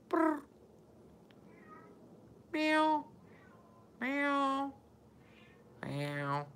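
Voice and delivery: high squeaky meowing at the cat. Pretend. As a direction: call the cat with meows. Mimicking cat to pet.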